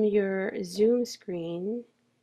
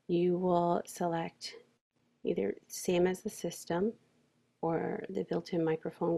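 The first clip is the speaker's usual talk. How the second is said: ordinary delivery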